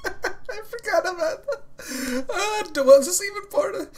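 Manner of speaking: high, slow words through tears of laughter; laugh-crying. Real, not acted. Laugh-crying over the scene. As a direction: talk while crying with laughter. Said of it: "I'm fucking crying".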